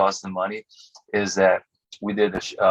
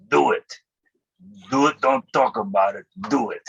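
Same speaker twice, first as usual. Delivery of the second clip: retold fatherly command, higher on the first words; emphatic urging; 3 semitones higher than usual. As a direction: urge him on, in short separate bursts. Father urging son in retold talk.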